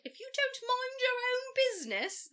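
hammed-up high-pitched scolding woman; mock prim lady voice. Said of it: "they probably didn't sound like that".

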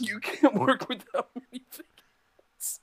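says it through laughter; laughing. Real, not acted. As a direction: laugh while repeating the complaint. Laughing echo of complaint.

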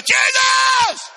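high, full-force cry over the crowd. Retold or imitated. Preacher acting blind man defying the crowd, huge shout. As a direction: scream out for your Savior, hammed-up. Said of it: "Bible says he shouted all the more".

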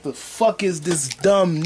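normal talking voice

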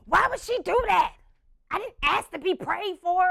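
put-on high, loud, indignant child; mad child. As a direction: whine furiously like a woken kid. Angry child sister voiced.

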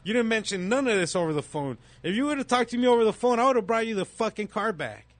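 high, loud fast retold yelling at the dealer. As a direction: yell it back at him, rising and fast.

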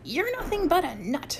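high, restrained scream; screamed insult. A narrator's reading. Harold insulting Mike in a cabin squabble, screaming. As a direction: hurl the insult with pitch shooting up. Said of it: "screamed Harold".

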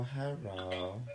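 ordinary talk

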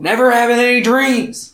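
high, emphatic retold rant; mock scolding. Retold scolding of young people.

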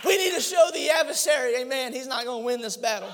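loud, high impassioned shouting; preaching at full force. Acted. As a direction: build to a roaring altar call.

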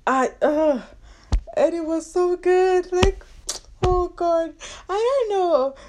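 high, strained upset voice; distressed. Real, not acted. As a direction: near tears over lost work. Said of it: "the reason why I sound like this".

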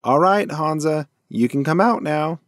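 calling out. A narrator's reading. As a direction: call out to someone hidden. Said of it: "Dash called out to Hansa".

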